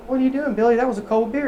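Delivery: lightly voiced friend asking what on earth; baffled buddy Jim.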